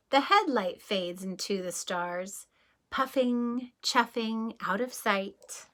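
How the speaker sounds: calm bedtime read-aloud; storybook reading. Acted. Reading the departing train page to children, calm read-aloud. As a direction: let the train roll gently away. Reads picture book aloud.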